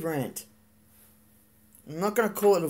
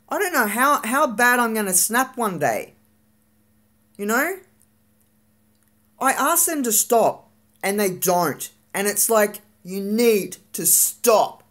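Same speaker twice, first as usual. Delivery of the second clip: higher, slightly louder, venting in real frustration; angry rant; 6 semitones higher, 3 dB louder than usual. Real, not acted. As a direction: let the anger spill out as you tell it. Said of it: "This is more of a v-, of a rant than a vlog".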